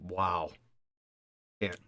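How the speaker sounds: normal talking voice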